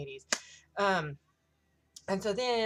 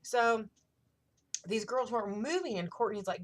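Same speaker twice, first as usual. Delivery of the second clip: ordinary talk, nothing marked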